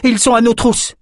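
very high urgent shout; alarmed cry. Acted. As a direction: call for help on the run. Fugitive cries out a warning.

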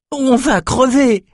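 cri indigné, bien plus aigu et lent; le bourgmestre proteste, désespéré et outré. A narrator's reading. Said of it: "s'indigna le bourgmestre". (le bourgmestre face aux soldats, cri d'indignation)

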